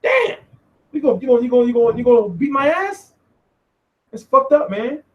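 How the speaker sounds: high, teasing mock hurt at Broner; hammed-up fake worry to a threat; mocking reply. Mocking Broner's threat, playing scared. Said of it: "So I was like".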